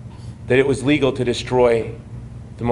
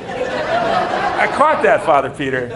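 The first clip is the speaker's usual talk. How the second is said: laughing tease; 3 semitones higher, slower than usual. Real, not acted. Teasing the priest in audience.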